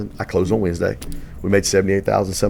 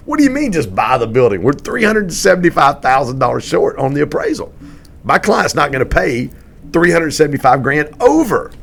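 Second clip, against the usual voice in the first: loud, high-pitched retold disbelief; exasperated protest; 8 semitones higher, 6 dB louder than usual. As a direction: argue back, voice raised in acted outrage. Acted argument against overpaying for building.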